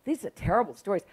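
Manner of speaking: usual speaking voice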